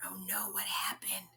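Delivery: hushed, unvoiced worried whisper. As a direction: whisper it like a secret worry to the kids. Reader pretends worry to kids.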